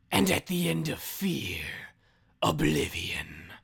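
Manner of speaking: dragged out, sinister villain voice; Scarecrow voice. Shown as a demonstration. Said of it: "I'm gonna do it in a voice".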